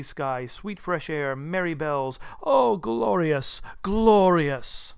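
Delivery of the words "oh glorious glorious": loud, high, drawn-out delight; rapturous exclamation. Acted. Scrooge at the open window, slow loud rapture. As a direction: savour it with soaring joy.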